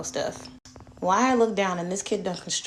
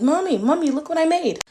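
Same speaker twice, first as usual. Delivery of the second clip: put-on high, loud kid calling Mommy; excited little son; 9 semitones higher, 7 dB louder than usual. Four-year-old calls mom to see his Lego, high excited voice. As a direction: imitate a child eagerly showing off. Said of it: "he calls me into his room".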